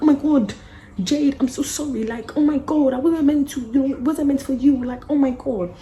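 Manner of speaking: high, loud panicked sorry; frantic apology. Retold or imitated. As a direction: gush out the apology.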